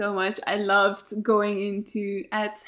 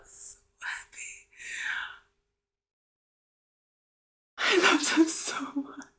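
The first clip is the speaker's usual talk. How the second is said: tender whisper; 5 semitones higher, 5 dB quieter than usual. Real, not acted. Emotional soft whisper after scene.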